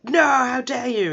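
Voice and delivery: loud, high, drawn-out indignation; hammed-up heroic fury; mock outrage. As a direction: cry out slowly in theatrical outrage. Friend outraged on finding him crying.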